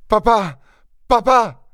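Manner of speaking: high-pitched calls for help; urgent calling. A narrator's reading. Son calling his father to the wounded mother, urgently. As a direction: call out twice, pitch leaping up.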